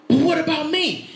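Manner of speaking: high-pitched mock protest; indignant older brother. Older brother comparing himself to the prodigal, mock indignant protest.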